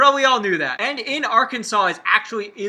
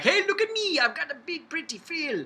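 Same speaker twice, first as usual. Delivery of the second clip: high, preening dinosaur voice; a dinosaur showing off its frill; 8 semitones higher, 5 dB quieter than usual. Pretend. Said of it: "That's how they talk".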